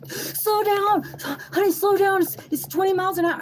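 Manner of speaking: high, loud panicked warning; alarmed cry. Retold or imitated. She warns the speeding driver, alarmed. Imitating alarmed passenger on phone.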